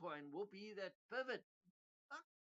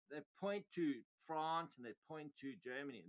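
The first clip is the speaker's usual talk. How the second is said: ordinary delivery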